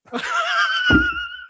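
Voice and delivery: high squealing laugh at a failed accent; sudden high-pitched giggle; embarrassed laugh. Real, not acted. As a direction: laugh off the botched attempt. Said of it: "No, that was not right".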